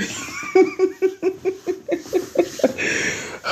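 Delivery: high, long laughing fit; helpless laughter. Real, not acted. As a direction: crack up at your own joke. Cracking up at own riff.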